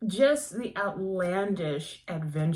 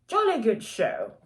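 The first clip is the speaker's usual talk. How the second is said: hammed-up British accent, higher; 4 semitones higher than usual. Reviewer praising the book to viewers, terrible British accent. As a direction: cheer it in a deliberately bad British accent. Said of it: "that was a terrible British accent".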